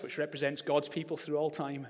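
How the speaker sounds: normal talking voice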